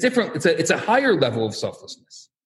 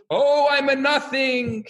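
higher, hammed-up cry of humility; a retold yeshiva student's mock wail; mock scream; 7 semitones higher, slower than usual. Yeshiva student screaming across the study hall, mock wail. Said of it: "the other one's screaming".

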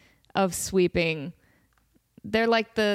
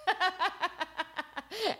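high breathy giggling; high giggle; 9 semitones higher, 8 dB quieter than usual. Real, not acted. Laughing hard at memory.

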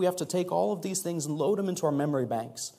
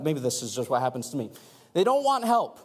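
ordinary talk

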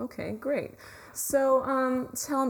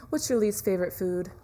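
ordinary delivery

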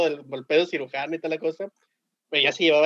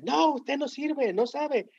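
mock angry outburst; 10 semitones higher, much slower than usual. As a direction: imitate an angry student's high-pitched rejection. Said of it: "se enoja". (imitando un reclamo enojado, agudo y alargado)

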